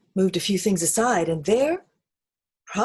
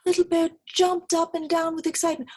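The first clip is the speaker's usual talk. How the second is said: excited; 14 semitones higher, 6 dB louder than usual. Acted. Excited discovery.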